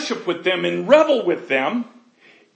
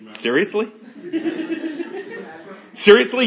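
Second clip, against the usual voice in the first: exasperated God; 5 semitones higher, 6 dB louder than usual. Pretend.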